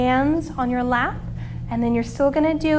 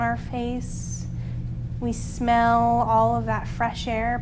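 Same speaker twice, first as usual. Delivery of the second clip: soft, calm meditation voice; calming guided relaxation; 3 semitones lower, 5 dB quieter than usual. Acted. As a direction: guide the kids into rest gently.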